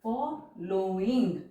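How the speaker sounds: Following drawn out with लो and इंग apart; slow, vowels split apart. Shown as a demonstration. Teacher sounding out the diphone for the class, slow. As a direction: stretch following so the two vowels separate. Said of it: "यहाँ पे पहले गो की sound आ रही है".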